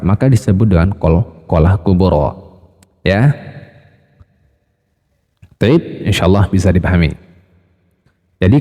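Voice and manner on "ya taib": ordinary talk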